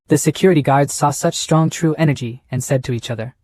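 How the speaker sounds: ordinary delivery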